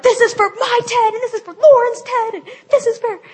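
high, loud battle cries; mock avenging shout. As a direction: dedicate each punch with hammed-up fury. Acted battle cries while kickboxing.

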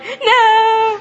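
loud, high-pitched cry of "too late"; mock horror. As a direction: wail it in hammed-up dismay. Retold regret after pushing send.

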